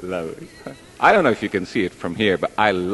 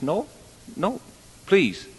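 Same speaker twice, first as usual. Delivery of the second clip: high-pitched begging refusal; acted-out monkey's plea; mock pleading; 10 semitones higher than usual. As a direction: plead your way out of it, playfully. Monkey pleads with God in joke.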